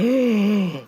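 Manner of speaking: hammed-up audience gasp; crowd gasp. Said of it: "And everyone's like".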